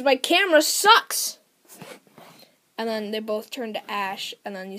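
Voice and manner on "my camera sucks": exasperated gripe, rising higher and louder. Real, not acted. Kid grumbling to viewers about the camera, exasperated. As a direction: build up to a loud, frustrated complaint.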